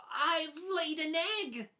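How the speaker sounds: overjoyed little pig; excited cry. Acted. Pig finds the egg and cries out in delight. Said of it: "cried Pig".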